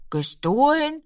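high, questioning repeat of the word; puzzled child's question; questioning echo. A narrator's reading. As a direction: echo it back high, as if weighing it. Boy questions the word stolen.

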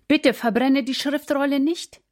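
understated high-pitched entreaty; pleading. A narrator's reading. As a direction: gently beg the king to stop. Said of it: "baten drei von ihnen".

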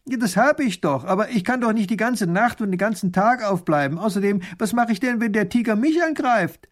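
high, distressed complaint; worried shepherd calling out his objection; agitated protest. A narrator's reading.

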